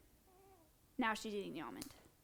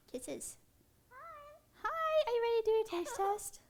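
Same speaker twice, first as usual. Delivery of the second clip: high squeaky pet voice, slow and sing-song; 9 semitones higher than usual. Real, not acted. Coos at the bird.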